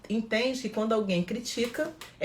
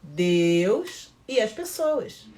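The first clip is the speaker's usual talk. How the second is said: slow emphatic; much slower than usual. Shown as a demonstration.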